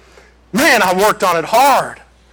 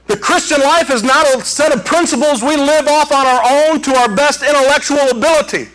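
raised, driving pulpit voice; preaching at full force; 7 semitones higher than usual. Acted. Preacher to congregation, high driving preaching. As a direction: preach it up to the back row.

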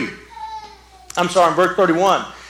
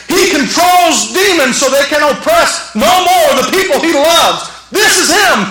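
rising, louder and higher preaching crescendo; fiery preaching; 7 semitones higher, 4 dB louder than usual. Acted. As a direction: build each line like a revival preacher. Preacher builds to climax.

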